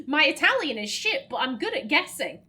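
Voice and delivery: ordinary talk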